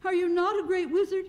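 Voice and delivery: high-pitched incredulous question; shocked cry. A narrator's reading. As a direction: demand it in high-voiced disbelief. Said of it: "cried Dorothy".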